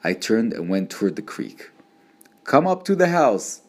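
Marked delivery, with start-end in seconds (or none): 2.4-3.6 high-pitched, serious call to little brother; ominous summons. A narrator's reading. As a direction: call him in as if trouble is waiting. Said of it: "the way I said it let him know".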